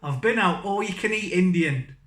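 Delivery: ordinary delivery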